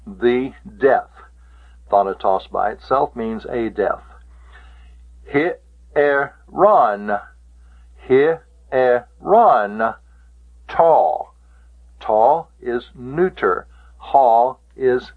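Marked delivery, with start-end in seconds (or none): 5.3-10.0 HIERON in three slow separated syllables, hi-e-RON. Shown as a demonstration. Teacher shows students the three syllables of hieron, sounded out slowly. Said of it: "for the, uh, pronunciation".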